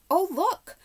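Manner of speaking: high excited exclamation; cried out. A narrator's reading. Polly sees the horse came too, excited cry. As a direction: call out in delighted surprise. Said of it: "Polly cried out".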